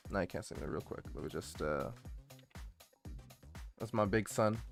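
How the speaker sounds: ordinary talk, nothing marked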